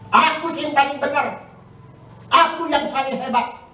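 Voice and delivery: a hint of a smug know-it-all; mock boasting, chin up; boastful spouse. Preacher mimics a spouse who thinks he is always right, smug.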